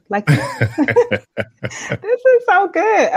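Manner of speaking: high, slow, laughing praise; laughing and delighted. Real, not acted. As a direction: gush through a laugh. Delighted by the advice.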